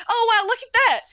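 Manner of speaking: high, loud delighted cheer; excited exclaim. Real, not acted. Game master cheering as the door breaks open, delighted. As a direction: whoop as the lock gives way.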